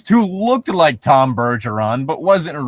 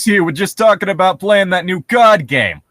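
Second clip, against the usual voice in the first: lightly voiced bro friend; 6 semitones higher, 4 dB louder than usual. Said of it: "Say more things".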